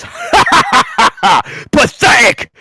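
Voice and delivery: very high, slow, loud mocking shout; villain mocking the hero after cutting him; cackling villain taunt. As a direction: cackle then sneer it down at him. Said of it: "laughs and goes".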